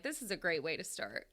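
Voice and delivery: lip sucked in, cold sore. Real, not acted. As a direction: talk with bottom lip in mouth. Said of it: "my bottom lip is in my mouth because I have a cold sore".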